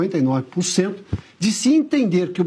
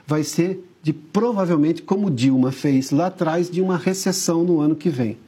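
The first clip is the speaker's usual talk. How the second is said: ordinary talk, nothing marked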